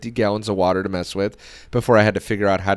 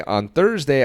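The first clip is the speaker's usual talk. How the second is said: ordinary talk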